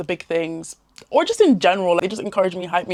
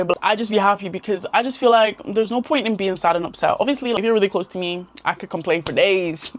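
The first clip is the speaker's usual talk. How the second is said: ordinary talk, nothing marked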